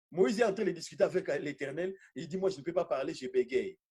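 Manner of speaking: normal talking voice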